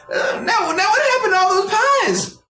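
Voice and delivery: put-on high, loud old man calling after you; high old-man voice asking after his pies; old shopkeeper calling. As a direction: call out like a fussy old baker.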